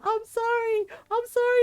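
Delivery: put-on high, pleading child's voice; mock shrieking. Little brother apologizing to the monster, mock shrieking. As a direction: imitate a terrified boy begging forgiveness. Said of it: "and he's shrieking".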